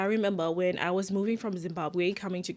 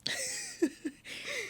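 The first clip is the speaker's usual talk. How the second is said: high, breathy nervous giggle; nervous laugh; 8 dB quieter than usual. Real, not acted. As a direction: giggle out of nerves. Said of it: "That's a nervous laugh".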